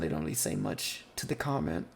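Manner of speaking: ordinary talk, nothing marked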